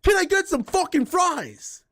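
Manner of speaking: high, slow mock demand for fries; mock rude demand. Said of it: "a McDonald's worker and be like".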